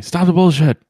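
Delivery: loud, high heckle; mock yelling. Interrupting someone pitching TV ideas, mock yell. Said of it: "you just yelling".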